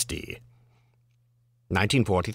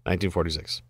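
ordinary talk

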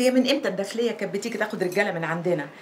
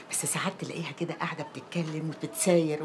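gossiping whisper; 6 semitones lower, 6 dB quieter than usual. Acted. Gossip about neighbour.

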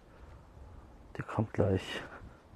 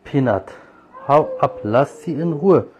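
loud, higher-pitched, forceful telling-off; real scolding, order the animal off loudly; angry scolding; 5 semitones higher, 9 dB louder than usual. Real, not acted. Owner telling Peanut to leave her alone, angry scolding.

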